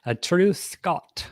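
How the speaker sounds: a hammed-up Scottish accent; a clumsy Scottish accent, on purpose; bad Scottish accent. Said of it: "That was a terrible accent".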